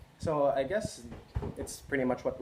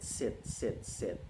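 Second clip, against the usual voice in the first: each sit softer and lower; fading repetition; 3 semitones lower, 4 dB quieter, much slower than usual. Acted. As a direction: let the sits trail away. Repeated words fading out.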